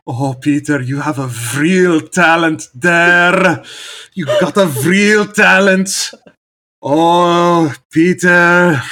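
loud, high, slow Frisian American grandma voice; Frisian American accent. Shown as a demonstration. Grandparents praising Peter, loud Frisian American accent. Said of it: "a good Frisian American accent".

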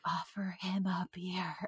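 slow, soft whisper; whispered inner voice. Retold or imitated. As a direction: whisper it like a voice in the head. Inner voice quoted to her.

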